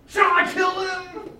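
the king can hardly wait to kill them; very eager. Acted. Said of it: "He really wants to kill them".

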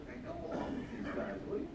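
hushed, high, breath-only aside; confidential messenger. Acted. King's messenger coaxing God's prophet, hushed nudge. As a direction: slip it to him under your breath. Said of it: "the king's messenger tells God's prophet".

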